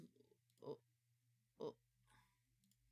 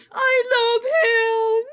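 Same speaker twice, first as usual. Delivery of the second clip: high, loud, slow gush; adoring squeal; 12 semitones higher, 12 dB louder, much slower than usual. Real, not acted. Streamer gushing to chat about her new character. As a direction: squeal with adoration.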